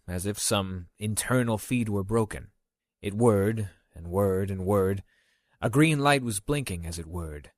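usual speaking voice

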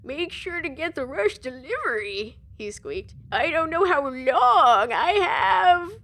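put-on squeaky, slow old man voice. A narrator's reading. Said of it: "with an old man voice".

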